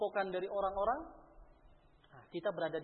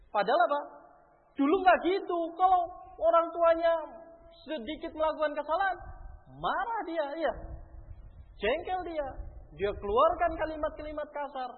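fervent preaching; 11 semitones higher, 12 dB louder than usual. Acted. Preacher raising voice on point.

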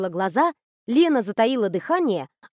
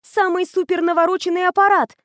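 put-on high, eager girlish gush; admiring rattle; 8 semitones higher, 4 dB louder than usual. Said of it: "восхищённо затараторила Аня".

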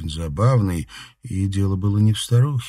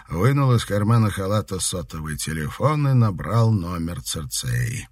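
plain reading voice

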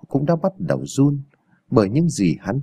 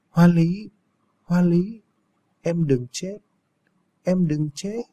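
drawn-out plea, pitched up, rising at the ends; delirious calling; 5 semitones higher, slower than usual. A narrator's reading. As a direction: call for your wife out of delirium. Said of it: "mê sảng, hễ hơi tỉnh dậy là cậu ta cứ gọi tên".

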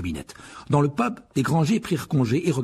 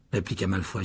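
plain reading voice

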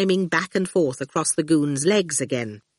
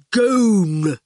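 goon voice; 3 semitones higher, 4 dB louder than usual. A narrator's reading. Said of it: "he said".

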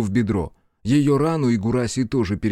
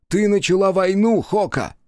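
angry accusation; 8 semitones higher, 3 dB louder than usual. Acted. Accusing Hoka.

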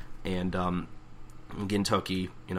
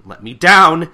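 loud, higher acted demand; angry protest; 7 semitones higher, 12 dB louder than usual. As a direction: shout to be released. Acted protest while being waved around.

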